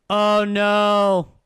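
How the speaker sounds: loud, high dismayed oh no; startled, loud dismay; dismayed groan. Real, not acted. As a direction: react to bad news with real dismay. Dismay at a bad trade.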